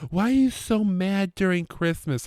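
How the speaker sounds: high-pitched mock wife voice; high, put-on questioning; imitated scolding wife. Wife's challenge in retold quarrel.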